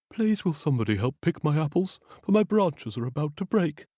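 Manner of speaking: a hint of a sad tree; lightly voiced pleading tree. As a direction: gently beg for help. Weeping apple tree pleads.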